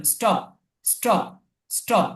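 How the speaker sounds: স্টপ with ট, no puff of breath; unaspirated T. Shown as a demonstration. Teacher corrects the reading for students, স্টপ with ট sound. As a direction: say স্টপ with a plain retroflex T. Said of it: "ট এর উচ্চারণ".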